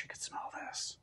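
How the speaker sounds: hushed, breathy whisper. Real, not acted. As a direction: whisper it in delight. Savoring the smell.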